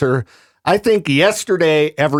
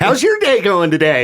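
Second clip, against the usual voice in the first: higher, lightly hammed-up cheery cashier; play-acted friendly small talk; mock chipper greeting; 4 semitones higher than usual. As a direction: imitate a perky drive-thru greeter. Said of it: "get all chipper and cheery".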